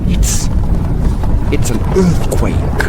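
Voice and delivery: loud, halting, frightened; stammering alarm. Acted. Soldier shouting over the earthquake, stammering in fear.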